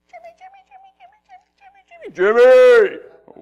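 faint high chattering voices; tiny gnat voices. Retold or imitated. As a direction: squeak like little gnats calling. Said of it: "little gnats screaming your name".

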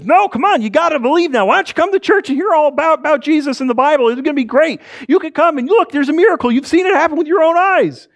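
high, loud, excited pleading; fast, high-pitched enthusiasm, pushing hard to convince; eager urging. Acted. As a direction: gush loudly like an overexcited believer. Eager man urging church visit.

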